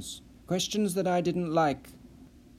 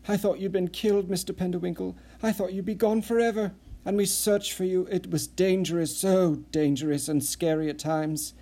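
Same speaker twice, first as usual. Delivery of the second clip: higher, breathless gushing voice; eager Nissen; 5 semitones higher than usual. Acted. Nissen gushing relief to the rescued Perry, higher voice. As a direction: pour it all out in a rush of relief.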